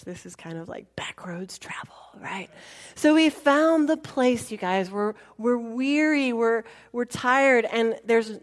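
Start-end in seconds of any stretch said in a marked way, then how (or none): 0.0-2.5 whispered aside. Real, not acted. Telling viewers on the quiet, whispered aside. 4.9-7.7 weary. Real, not acted. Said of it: "weary, tired".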